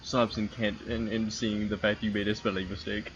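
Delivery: normal talking voice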